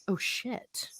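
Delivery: soft breathless dismay; stunned whisper. Pretend. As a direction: whisper it, caught off guard. Ringwraith voiced in doubt.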